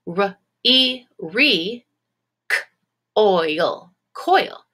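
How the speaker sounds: R, E, re, k, oil, coil said in pieces; tapping out syllables. Shown as a demonstration. Teacher tapping out syllables with the class. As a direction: sound each piece out clearly. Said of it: "So here we go. Ready?".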